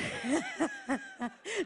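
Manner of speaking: a short high burst of laughter. Real, not acted. Laughs at own hardships.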